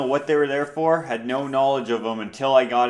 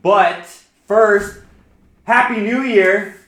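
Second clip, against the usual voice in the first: loud, high New Year cheer; yelling; 8 semitones higher, 9 dB louder, slower than usual. Real, not acted. Host yells a New Year greeting to listeners, yelling. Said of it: "why you were yelling".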